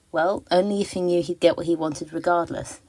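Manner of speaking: ordinary talk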